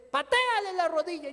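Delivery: loud, firm repeated command; insistent command. Retold or imitated. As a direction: insist the second time.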